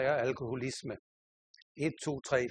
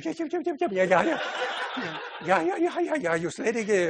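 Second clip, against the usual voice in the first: flustered laughing yeses; 8 semitones higher, 5 dB louder than usual. Retold or imitated. Flustered reply to grateful visitor.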